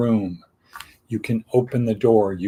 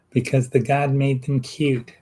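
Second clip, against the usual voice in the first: put-on lightly accented and higher, "the God made them cute"; playful mock accent on a joke line; mock foreign accent; 4 semitones higher than usual. Comic repeat of a line.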